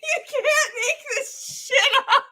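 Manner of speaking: high squeal through real laughing; helpless laughter. Real, not acted. Amazed at the clip's pipsqueak line, helpless high laughter. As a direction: gasp it out while cracking up. Cracking up at the played clip.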